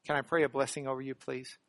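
normal talking voice

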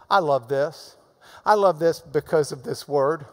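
normal talking voice

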